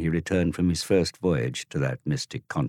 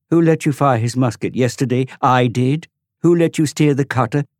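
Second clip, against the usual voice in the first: put-on high, louder boy's retort; rounding on him; 9 semitones higher, 8 dB louder than usual. Tom rounding on Dorian, high loud retort. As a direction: hammer him with each question. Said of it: "Tom rounded on him".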